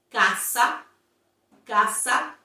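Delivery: Casa with clear open a; Casa langsam mit offenem a vorsprechen; model Spanish. Shown as a demonstration. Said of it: "An example with, uh, the vowel A".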